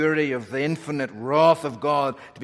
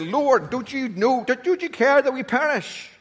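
high, pleading cry of fear; panicked disciples; 10 semitones higher, 5 dB louder than usual. Acted. Disciples in the sinking boat crying out in panic. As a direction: beg like men about to drown.

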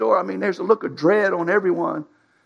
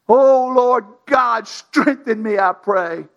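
crying out in prayer; 4 semitones higher, 4 dB louder, much slower than usual. Retold or imitated. Samson crying out to God.

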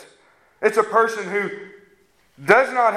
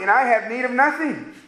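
higher, puffed-up boast; high mocking boast of self-sufficiency; smug self-righteous man; 8 semitones higher than usual. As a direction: sneer it like a proud rich man. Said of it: "You say".

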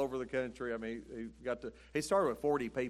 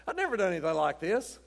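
laughing; 5 semitones higher, 4 dB louder than usual. Real, not acted. Laughing at own embarrassment.

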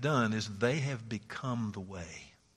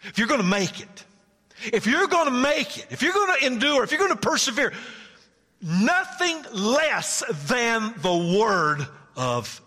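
emphatic proclamation; 11 semitones higher, 7 dB louder than usual. Acted. Preacher voicing God telling Israel what they need. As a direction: press it home, building each phrase. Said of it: "And God said".

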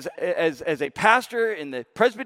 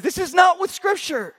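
Luther's protest; 13 semitones higher than usual. Retold or imitated. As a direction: protest against the church. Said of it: "he said".

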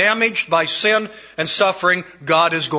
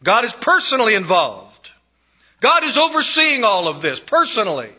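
fervent preaching; 7 semitones higher, 4 dB louder than usual. Acted. Preacher assuring sufferers God watches them, fervent. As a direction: proclaim it with conviction.

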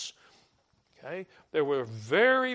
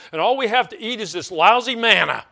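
louder, higher, fast griping; mock whining complaint; 6 semitones higher, 10 dB louder, faster than usual. Israelites griping to Moses about the manna, mock whine. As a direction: whine about the food like a spoiled crowd. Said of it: "they finally say".

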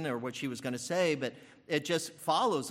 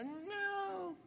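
a drawn-out, high, hushed movie-style no; slow-motion movie no; 9 dB quieter than usual. Retold or imitated. Grandma's cry replayed as a slow-motion TV moment, drawn-out high no. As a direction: play it like a slow-motion film scene. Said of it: "You know, the slow motion".

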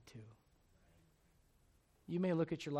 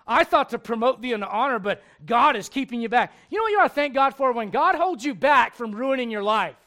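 much louder, higher fervent preaching; preaching at full force; 6 semitones higher, 17 dB louder than usual. Acted. Preacher urging thanks to God, full-force preaching. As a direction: drive it home to the congregation.

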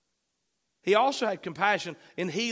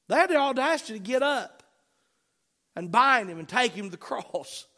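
loud, higher-pitched pulpit delivery; fired-up preacher; impassioned preaching; 6 semitones higher, 7 dB louder than usual. Real, not acted. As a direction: preach it with force, hammering the key words. Preaches at full force.

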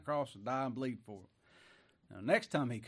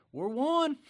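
much higher, louder triumphant shout; exultant cry; 12 semitones higher, 7 dB louder than usual. Real, not acted. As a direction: proclaim it to the church. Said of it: "preaching climax".

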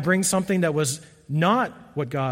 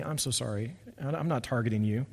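soft, lower and sincere; sincere apology; 5 semitones lower, 9 dB quieter than usual. Real, not acted. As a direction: apologize gently, almost to yourself. Apologizing to offended listeners.

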